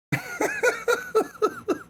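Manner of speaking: high burst of laughter. Real, not acted. Laughing at his own retold outburst, high laugh. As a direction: crack up after the punchline.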